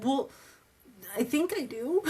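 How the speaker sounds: high, innocent and sure; high, innocent reply to the roommate; naive reply. Retold or imitated. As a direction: answer high and innocent, half joking. Her own naive reply.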